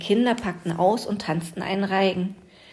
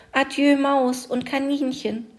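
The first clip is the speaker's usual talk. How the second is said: tearful farewell; 7 semitones higher than usual. A narrator's reading. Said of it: "es weinte bitterlich".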